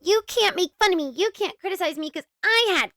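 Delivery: high mocking imitation of her whining; mock whiny YouTuber. Commentator mocking the YouTuber's cancer excuse, high whiny voice.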